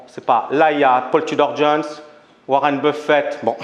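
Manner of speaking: ordinary talk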